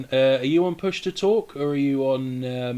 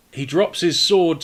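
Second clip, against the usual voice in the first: usual speaking voice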